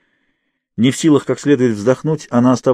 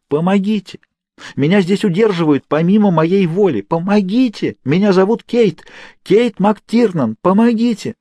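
screaming for help; 9 semitones higher than usual. A narrator's reading. Captive woman shouting her name to be heard. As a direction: yell through the door for rescue.

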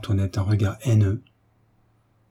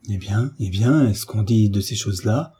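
plain reading voice

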